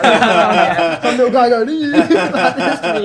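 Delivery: high and loud, laughing all through; laughing hard. Retold or imitated. Mimicking the guy, laughing.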